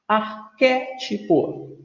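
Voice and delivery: "Arquétipo" slowly, syllable by syllable, stressing QUÉ; ar-QUÉ-ti-po for "Arquétipo", with pauses; stressed antepenult syllable. Shown as a demonstration. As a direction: break up "Arquétipo" and land on QUÉ. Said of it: "antepenúltima".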